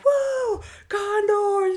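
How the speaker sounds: very high, held-out team cheer; imitated fan cheer. Fan cheering on the Condors, high drawn-out whoop. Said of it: "And the guy's like".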